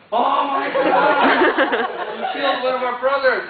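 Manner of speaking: loud, high, laughing mock outcry; monks shrieking with laughter. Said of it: "all the monks went".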